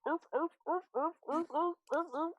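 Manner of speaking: high, loud seal barking; repeated yelping seal calls; seal barks. Acted. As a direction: imitate a pack of barking seals. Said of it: "you hear, like".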